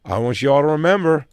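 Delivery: loud, high radio-host voice; radio host imitation. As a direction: mimic the host lecturing listeners about water. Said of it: "Then she goes".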